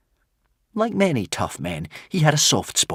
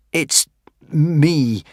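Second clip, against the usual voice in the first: stuttered; 5 semitones higher, 3 dB louder than usual. A narrator's reading. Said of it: "stuttered Hiccup".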